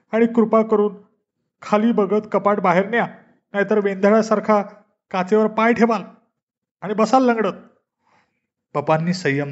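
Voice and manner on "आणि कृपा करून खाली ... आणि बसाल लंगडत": high, whiny, half-crying voice; tearful nagging. A narrator's reading. Injured mom nags dad while crying, high and whiny. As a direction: nag him through mock tears. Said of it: "mummy लगेच रडत म्हणाली".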